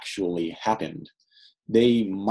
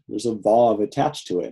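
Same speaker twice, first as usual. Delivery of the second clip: ordinary talk, nothing marked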